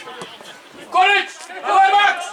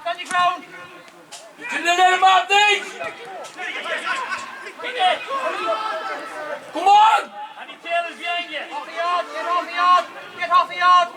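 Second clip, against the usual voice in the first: sideline shouting; 12 semitones higher than usual. Real, not acted. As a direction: bellow encouragement from the touchline. Yelling at players during the match.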